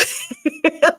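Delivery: a loud, high burst of laughter. Retold or imitated. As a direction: cackle up high mid-tease. Laugh inside teasing reply.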